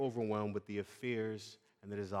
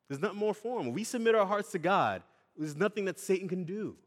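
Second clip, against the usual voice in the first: preaching at full force; 7 semitones higher, 5 dB louder than usual. Acted. Preaching climax, louder and higher.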